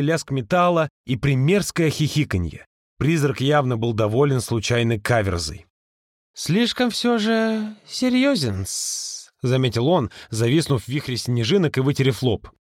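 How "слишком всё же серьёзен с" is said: high, slow, drawling tease; teasing old servant. Acted. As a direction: tease him slowly. Ghost's slow teasing remark.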